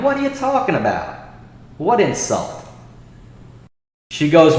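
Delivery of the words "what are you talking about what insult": high-pitched, baffled retelling; incredulous retort. Retold or imitated. Streamer retells answering the claimed insult, incredulous. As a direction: shoot the pitch up in disbelief. Acting out his baffled reply.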